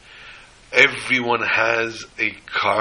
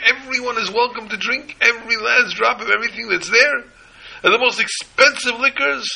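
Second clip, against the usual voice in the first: much higher and louder, ranting at full force; steaming mad; 12 semitones higher, 6 dB louder than usual. Retold or imitated. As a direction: let the fury boil over as you announce the open bar. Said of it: "He has steam coming out of his ears".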